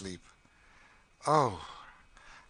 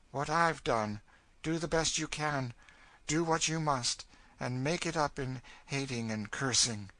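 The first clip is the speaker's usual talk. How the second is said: somewhat higher, forceful, bitter advice; hard, bitter woman; 6 semitones higher, 3 dB louder than usual. Acted. Cassie advising the frightened girl, hard bitterness. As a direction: give the advice bitterly and firmly.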